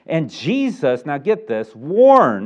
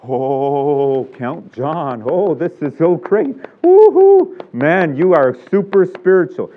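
admiring crowd; 3 dB louder, slower than usual. Retold or imitated. Onlookers praise Count John, gushing and excited. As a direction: fawn over the big giver.

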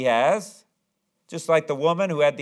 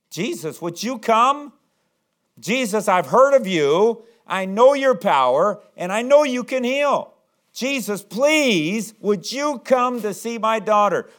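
raised, impassioned plea with drawn-out phrases; pleading; 6 semitones higher, 4 dB louder, slower than usual. Acted. Desperate father begging Jesus to heal his daughter, pleading. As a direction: plead earnestly, pitch climbing high.

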